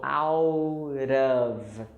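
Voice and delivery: "out of" very slowly joined, OWWW-duv; linked words. Shown as a demonstration. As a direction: stretch "out of" as one gliding word. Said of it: "it sounds like".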